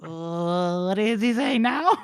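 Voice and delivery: drawn-out wail rising high; hammed-up dread climbing into a question; alarmed patient. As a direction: whine upward in comic bewilderment. Wounded patient confused and dreading.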